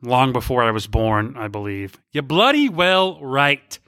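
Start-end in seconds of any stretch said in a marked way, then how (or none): 2.1-3.8 slow, clearly articulated mock British accent; put-on British accent. Shown as a demonstration. Host revealing the Supertramp lyric, slow British accent. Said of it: "That's actually a Supertramp song".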